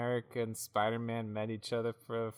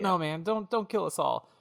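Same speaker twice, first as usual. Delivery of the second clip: pleading pawnbroker; 7 semitones higher, 4 dB louder than usual. Acted. Jeeves begs the big man.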